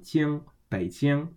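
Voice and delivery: "Jing" held high and level, slowly; first tone. Shown as a demonstration. Teacher models the syllable then the name, first tone. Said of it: "in the first tone".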